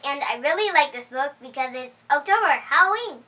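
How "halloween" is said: usual speaking voice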